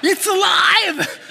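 hammed-up high shriek of horror, laughing; mock horror shout. Preacher mocking his own pile in horror, laughing shout.

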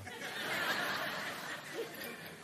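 breathy high giggle; light chuckle at himself; amused little laugh; soft laugh. Real, not acted. Laughs after smiling at librarian.

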